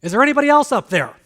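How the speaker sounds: loud, high, fast panicked plea; incredulous shout. Retold or imitated. Hanging man refuses to let go, shouting up fast and loud in disbelief. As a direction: yell past God for a second opinion. Punchline, man rejects Lord's advice.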